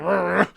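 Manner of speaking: loud animal growl; reindeer growl. Retold or imitated. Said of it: "Dasher's like".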